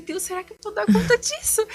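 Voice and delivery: high-pitched worried plea; anxious self-doubt. Retold or imitated. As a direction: plead upward, mock-panicked, doubting yourself. Retelling her doubt to God. (súplica aguda e insegura)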